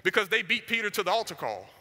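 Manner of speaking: normal talking voice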